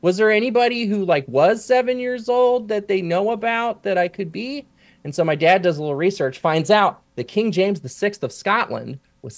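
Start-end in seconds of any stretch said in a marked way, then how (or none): 0.0-4.7 put-on high, quick child's question; seven-year-old self. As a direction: imitate an earnest kid asking the grown-ups. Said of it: "And I'm like".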